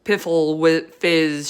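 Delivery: loud scornful giant shout. A narrator's reading. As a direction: shout it in contempt. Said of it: "shouted the blood bottler".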